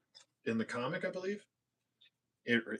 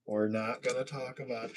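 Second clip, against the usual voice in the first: softer, toned-down refusal; hushed dismissal; 8 dB quieter than usual. Pretend. Host hushing up a mishap, softly. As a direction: drop it to a soothing hush.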